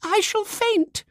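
high, slow, faint-hearted wail; quavering fright. A narrator's reading. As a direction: quaver like about to swoon. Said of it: "quavered Miss Simke".

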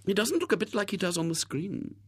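high-pitched genteel aunt voice; gushing aunt. A narrator's reading. Aunts remarking on William at the party, high and gushing. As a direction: voice a fussy aunt, pitched well up.

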